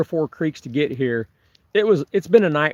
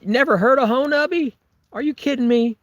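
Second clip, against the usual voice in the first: high-pitched disbelief; incredulous; 10 semitones higher than usual. Real, not acted. Streamer to viewer who never heard of Honubby, incredulous. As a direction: question it in amazement, pitched well up.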